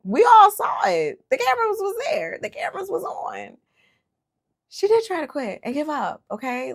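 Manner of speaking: high and loud, indignant; insist, nobody can deny it; indignant insistence. Real, not acted. Insisting she quit on camera.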